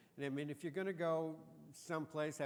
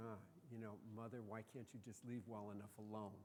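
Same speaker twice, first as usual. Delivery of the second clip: grumbling son; 4 semitones lower, 9 dB quieter than usual. Retold or imitated. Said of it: "He's going".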